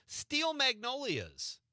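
high, slow, teasing last option; high drawn-out quiz option. Acted. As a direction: stretch it out like a game show host. Quiz host reading joke option.

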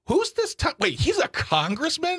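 very high, incredulous squeal; mock disbelief. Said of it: "It makes people go".

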